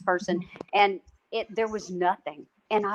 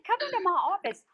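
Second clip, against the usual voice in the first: farmer's invitation; 12 semitones higher than usual. Retold or imitated. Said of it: "He said".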